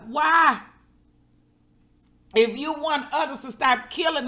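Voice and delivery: grieving the shooting, she shouts her plea to the community; passionate outcry. Real, not acted. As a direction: cry out against the killing, real and raw. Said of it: "I'm so passionate about that".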